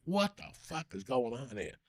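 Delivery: hushed amazement. Retold or imitated. Recalling the motorcycle on stage, hushed amazement. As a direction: drop right down as if stunned by the sight. Retells amazement at concert entrance.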